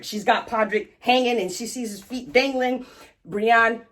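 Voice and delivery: ordinary delivery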